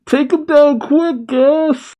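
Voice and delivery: very high, strained shout; Oil shouting. A narrator's reading. Panicked henchman ordering Gas to finish Vegeta, shouting. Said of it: "Oil shouts".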